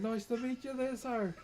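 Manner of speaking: high-pitched mock Irish greeting; imitated Irish accent. Irish patron greets the streamer, imitated Irish accent. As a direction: put on a lilting Irish voice, amused. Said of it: "he's got the Irish accent".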